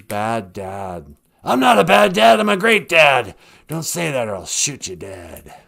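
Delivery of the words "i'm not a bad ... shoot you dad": Alec Baldwin. Acted. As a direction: protest loudly, then threaten under your breath. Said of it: "a murderous rasp to his voice".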